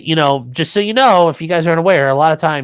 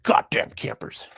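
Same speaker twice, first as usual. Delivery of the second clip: slow, breathy, drawn-out grumble; hushed mock curse; 6 dB quieter, slower than usual. Streamer reading a viewer's gripe about campers, hushed mock curse. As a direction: curse it slowly under your breath.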